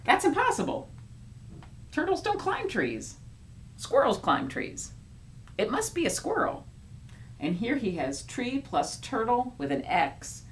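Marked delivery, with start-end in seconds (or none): 0.0-6.7 higher, insistent story character; lightly voiced know-it-all. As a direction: argue it can't be. Second character argues.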